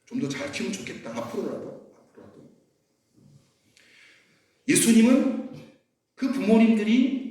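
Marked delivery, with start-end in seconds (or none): 0.0-2.5 soft, lower, fading to a whisper; quiet apologetic inner voice; regretful self-talk. Retold or imitated. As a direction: murmur it as a private regret. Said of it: "죄송스러운 마음도 있고".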